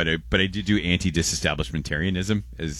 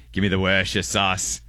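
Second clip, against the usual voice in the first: ordinary talk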